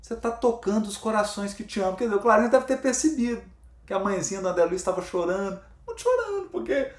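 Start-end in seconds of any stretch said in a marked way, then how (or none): 0.0-2.0 soft, quiet, fatherly comfort; quiet moved father figure soothing a son; moved and tender. Retold or imitated. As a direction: console him gently, a touch moved. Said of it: "Falou comovido".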